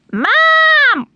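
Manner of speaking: high, piercing call for mother; screech. A narrator's reading. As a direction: screech like a demanding chick. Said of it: "she screeched".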